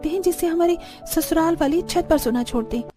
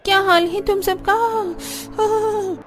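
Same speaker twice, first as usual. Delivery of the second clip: higher-pitched greeting trailing into a sigh; weary greeting; 9 semitones higher, much slower than usual. Acted. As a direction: greet the neighbours, then let out a tired sigh. Weary greeting, then yawn. (थकी हुई आवाज़ में हाल पूछना)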